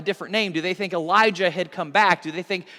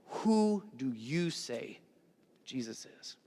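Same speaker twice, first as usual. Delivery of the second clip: slow hushed question; 5 semitones lower, 9 dB quieter, slower than usual. Acted. Heart of the sermon question.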